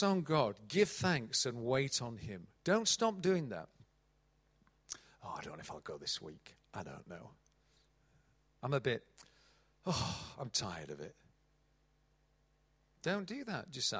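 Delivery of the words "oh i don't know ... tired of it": low, soft, tired mumble; weary reluctance. Acted. Churchgoer unsure about coming this week, weary reluctant mumble. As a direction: grumble half-heartedly, voice sunk right down.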